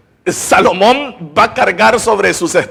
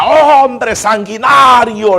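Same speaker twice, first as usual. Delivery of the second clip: loud, high, drawn-out mock yell; hurled curse; 5 semitones higher, 4 dB louder, much slower than usual. Simei pelting David with stones, loud imitated cursing. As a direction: hurl the accusation slowly across a distance.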